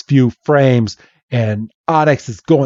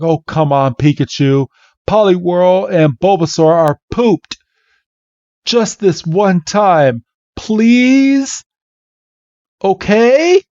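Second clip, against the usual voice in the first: pleading; 6 semitones higher than usual. Retold or imitated. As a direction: coax a stubborn pet, stretching the words. Red begs his reluctant Pikachu.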